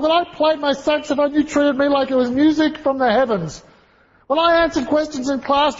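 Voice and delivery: put-on high, fast, desperate girl's voice; little Lisa pleading. Lisa begging her departing teacher not to leave, high pleading girl's voice. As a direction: squeak it out like a heartbroken nine-year-old. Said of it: "she runs up to him and she says".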